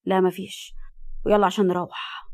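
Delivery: ordinary delivery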